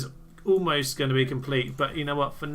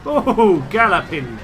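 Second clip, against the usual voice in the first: excited gasp; 5 semitones higher, 10 dB louder, slower than usual. Real, not acted. Sudden exclamation at battle.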